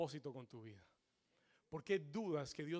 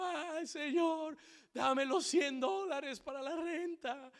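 slow, high wailing prayer; hammed-up drawn-out begging; mock tearful pleading; 10 semitones higher, 7 dB louder, slower than usual. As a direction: imitate a man crying out to God. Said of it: "clamándole al Señor, llorando".

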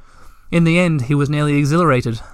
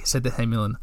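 ordinary delivery, nothing marked

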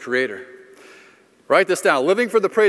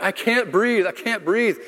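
put-on higher, gasping cry of a kid out of breath; retold panicked yell; 6 semitones higher, slower than usual. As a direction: call it out like a winded fourth grader. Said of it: "C J yells out".

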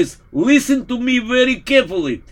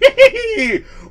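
mad laughter; 10 semitones higher than usual. Acted. Priest laughing madly.

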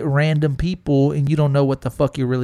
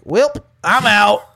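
mock blunt dismissal; 7 semitones higher, 7 dB louder, slower than usual. Girl rejecting fiancé.

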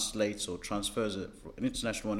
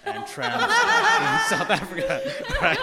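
high squealing laugh through the words; giggling tease; 9 dB louder, slower than usual. Real, not acted. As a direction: laugh it out while teasing. Teasing fellow South African, laughing.

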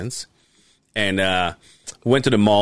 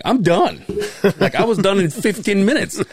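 high-pitched, comic exasperation; worked-up mock complaint; exasperated retelling; 8 semitones higher than usual. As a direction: protest through a laugh that you're over it. Retold exasperation at the mall.

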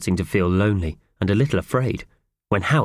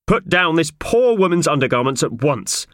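raised-pitch, outraged official; stern order; 8 semitones higher, 3 dB louder than usual. Acted. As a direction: command the culprit with indignant authority.

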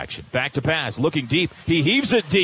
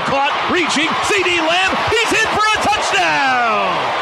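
rising, then a drawn-out call; excited touchdown call; 6 semitones higher than usual. Real, not acted. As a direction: build up to the touchdown.

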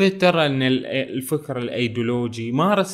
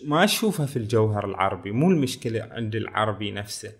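ordinary talk

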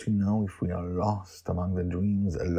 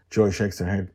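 plain reading voice

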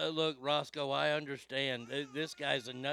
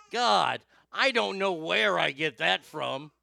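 loud rant; 4 semitones higher, 8 dB louder than usual. Real, not acted. Ranting about Tennessee voters.

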